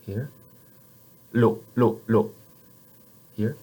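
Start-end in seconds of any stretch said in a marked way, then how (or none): 1.3-2.3 "le" with a light tongue-tip "l"; French L. Shown as a demonstration. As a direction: clear French "le". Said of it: "one more time".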